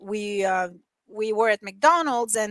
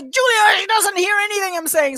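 high, loud half-crying complaint; frustrated husband; 10 semitones higher, 7 dB louder than usual. Retold or imitated. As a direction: wail it out in mock despair. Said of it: "so he's like".

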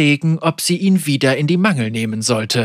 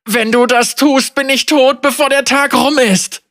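high, loud desperate begging; pleading; 9 semitones higher, 4 dB louder than usual. A narrator's reading. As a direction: beg for your life. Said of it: "flehte Devaki".

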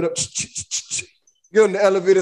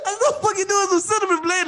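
high, laughing mock outrage; retold incredulous question; 9 semitones higher than usual. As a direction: question a friend in squealed disbelief. Retold disbelief at Blade's food.

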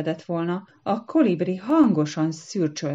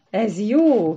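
loud, high delighted cry; exclaiming; 5 semitones higher, 8 dB louder than usual. A narrator's reading. Hummingbird cries out over the nectar, loud and high. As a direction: shout out the pleasure. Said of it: "kiáltott fel".

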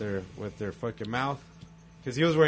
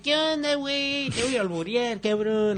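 high, sing-song Mexico City slang voice; mock a chilango, pitched up; hammed-up chilango accent; chilango accent impression; 12 semitones higher, 3 dB louder than usual. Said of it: "they're always like".

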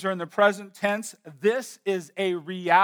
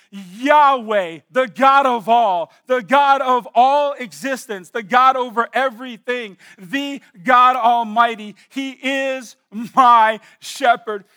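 louder, slow and building; preaching proclamation; 3 semitones higher, 6 dB louder, slower than usual. Acted. Preacher thundering David's words to the congregation. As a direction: proclaim each name of God.

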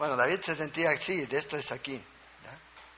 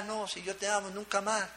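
softer, sugary lover's vow parody; mock empty promise; 3 semitones higher, 9 dB quieter than usual. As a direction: mimic a hollow 'I love you, never again'. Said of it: "No solamente bla, bla".

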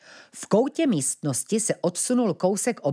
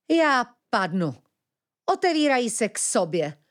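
higher-pitched voice for a storybook rabbit; lightly voiced rabbit, pitched up; exclaiming rabbit; 7 semitones higher than usual. A narrator's reading. Rabbit exclaims at how the doors open, higher exclaiming voice.

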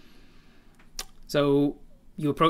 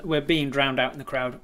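normal talking voice

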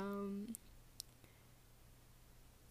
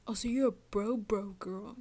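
mock commenter; 6 dB louder than usual. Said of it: "someone would even comment".

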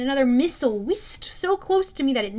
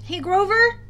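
raised, higher call to a friend; thought call; 8 semitones higher, 6 dB louder than usual. A narrator's reading. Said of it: "I thought".

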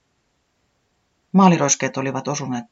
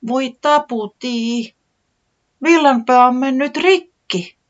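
high, loud, slow wail; exclaiming; 10 semitones higher, 5 dB louder, slower than usual. A narrator's reading. Tiputi telling Taputi the village is broken, high loud cry. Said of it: "huudahti Tiputi".

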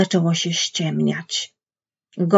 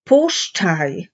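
ordinary delivery

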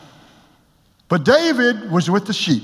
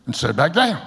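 ordinary talk, nothing marked

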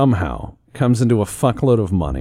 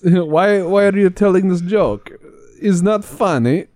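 mock pained Bond; 6 semitones higher than usual. Bond begs the villain to stop joking, higher whiny voice. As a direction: whine it at the villain. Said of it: "voicing Bond at villain".

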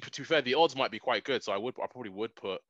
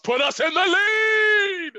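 real goal celebration, much louder; excited shout; 12 semitones higher, 10 dB louder than usual. Real, not acted. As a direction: yell at the telly as they score. Goal scored while watching live.

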